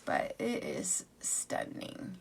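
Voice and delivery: slow awed whisper. Real, not acted. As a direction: hush it out slowly, admiring. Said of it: "I keep saying stunning".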